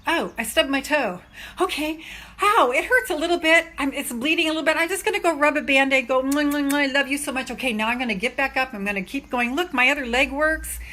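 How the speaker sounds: peppy self-encouragement; upbeat bounce-back. Pretend. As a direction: brush it off cheerfully and get up. Cheerful resilient version of same.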